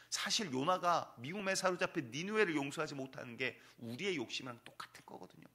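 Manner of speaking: normal talking voice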